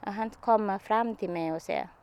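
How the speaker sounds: normal talking voice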